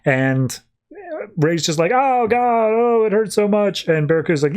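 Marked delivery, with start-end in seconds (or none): 1.9-3.9 Ray wails after Barracuda chops off his hand, pained and high; pained cry. Acted. Hand just chopped off.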